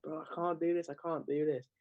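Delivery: retold scream. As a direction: imitate a strained protest without real volume. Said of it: "I was, like, screaming".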